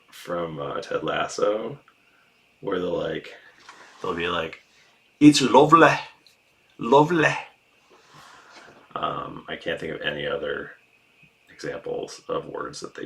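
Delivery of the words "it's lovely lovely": loud, high Mancunian accent. Shown as a demonstration. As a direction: belt it out in a high Manchester accent. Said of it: "doing a Mancunian accent".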